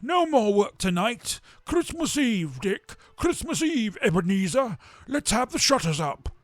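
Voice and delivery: put-on a fat merry master calling his boys; jovial Fezziwig. A narrator's reading. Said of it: "cried old Fezziwig".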